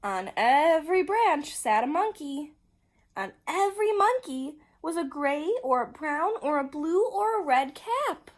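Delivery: loud, rising storyteller's excitement; emphatic, wide-eyed narration; big reveal. A narrator's reading.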